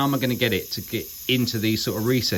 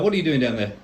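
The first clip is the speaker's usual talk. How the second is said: ordinary talk, nothing marked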